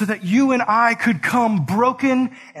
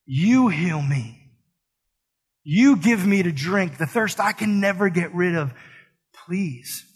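pleading; 3 semitones lower, slower than usual. Retold or imitated. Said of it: "you'd look back at me and say".

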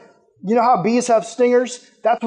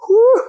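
whew; 5 dB louder than usual. Real, not acted. Preacher exhales after climax.

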